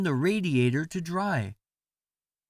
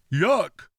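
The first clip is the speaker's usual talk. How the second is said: a loud disgusted yuck; shouted yuck; 4 dB louder than usual. Acted. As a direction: snap it out in disgust. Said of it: "They shouted".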